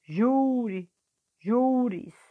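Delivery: "Júri, júris" with a long, high JÚ each time; teacher drawing out JÚ in "Júri, júris"; stretched stressed syllable. Shown as a demonstration. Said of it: "puxando mais a sílaba forte".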